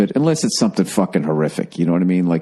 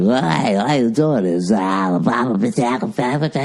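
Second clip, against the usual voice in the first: mock radio-host patter, higher-pitched; radio guy; 4 semitones higher, slower than usual. Comic recounting a radio guy's early phone call, mock radio voice. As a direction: imitate a radio guy blathering into nonsense. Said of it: "it was some fucking radio guy".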